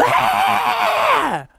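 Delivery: a high cheering yell; cheering shout. Retold or imitated. Teacher imitating the frog crowd cheering, a high shout.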